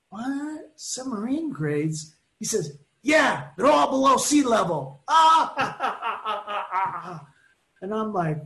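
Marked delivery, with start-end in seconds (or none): 0.1-2.1 puzzled disbelief. Retold or imitated. Puzzled reaction to joke. 3.0-5.0 loud, high, pleased punchline; proud dad shouting his own joke. Retold or imitated. Customer delivering corny punchline. 5.1-7.4 burst of laughter. Real, not acted. Laughing at the corny joke.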